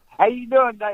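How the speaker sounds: high Appalachian hillbilly voice; put-on mountain twang through a fixed smile; Eastern Tennessee accent. Shown as a demonstration. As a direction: talk Eastern Tennessee with a stiff upper lip. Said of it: "They talk like this".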